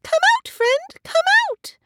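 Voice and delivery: very high, loud squeaky shout; tiny mouse yelling up a tree. A narrator's reading. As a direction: shout it in a piercing little mouse voice. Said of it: "Mouse starts to shout".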